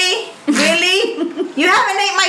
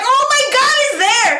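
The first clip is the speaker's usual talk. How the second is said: shocked; 8 semitones higher than usual. Retold or imitated. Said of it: "I'm like".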